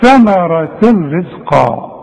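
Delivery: ثمرة رزقا recited slowly, the tanween of ثمرة merged into the ra of رزقا with no nasal hum; slow Quranic demo of ثمرة رزقا with full idgham; merged recitation. Shown as a demonstration. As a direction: draw ثمرة out slowly and slide straight into رزقا. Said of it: "هندغم إدغام كامل بدون غنة،".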